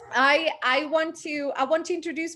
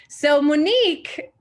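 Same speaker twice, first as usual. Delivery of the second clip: excited greeting; 5 semitones higher, 7 dB louder, slower than usual. Real, not acted. Host greets birthday guest.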